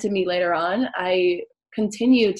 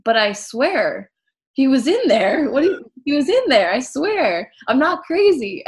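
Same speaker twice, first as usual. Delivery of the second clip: high, loud retold pleading; insistent protest; 6 semitones higher, 7 dB louder than usual. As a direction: insist to disbelieving parents, reenacted. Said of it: "And I'm like".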